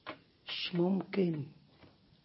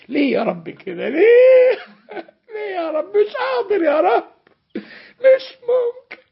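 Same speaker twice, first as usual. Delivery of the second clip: much higher and louder wailing, teary; high sobbing cry of despair; crying plea; 10 dB louder than usual. Acted. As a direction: wail to God that you can't bear it. Servant cries out for paradise.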